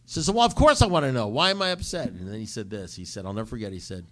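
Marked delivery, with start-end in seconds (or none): 0.3-2.1 high, loud, quick retold outburst; exasperated demand. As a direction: burst out begging for the answer.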